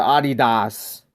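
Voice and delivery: Adidas said AH-dee-dahs; German-style Adidas with open ah vowels; German pronunciation. Retold or imitated. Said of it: "I saw the reporter called".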